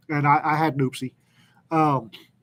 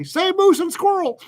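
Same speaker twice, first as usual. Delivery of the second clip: retold heckle; 4 dB louder than usual. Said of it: "yelled up at the".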